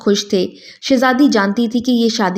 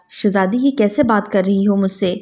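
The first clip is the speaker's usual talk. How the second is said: usual speaking voice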